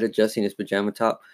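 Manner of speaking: ordinary delivery, nothing marked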